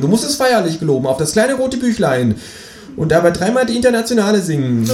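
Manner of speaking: high, insistent kangaroo voice; solemn kangaroo. Acted. Kangaroo makes the narrator swear secrecy, high insistent creature voice. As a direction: demand an oath in a high creature voice. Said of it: "sagt das Känguru".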